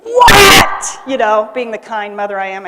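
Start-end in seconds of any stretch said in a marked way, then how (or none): 0.0-1.0 sudden loud yell; a mother's startled shout at her child; screamed what. Retold or imitated. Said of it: "I scream at my poor child".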